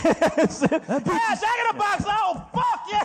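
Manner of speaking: very high, drawn-out cries of delight; mock ecstasy. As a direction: moan it out high and slow, hammed-up rapture. Acted wife in Jack commercial.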